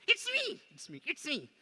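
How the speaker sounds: very high, loud puppet voice; indignant dummy. Acted. Dummy insists.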